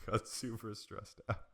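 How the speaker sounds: laughing through the words; giggling while reading sad news; nervous laugh. Real, not acted. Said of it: "It's, like, my nervous laugh".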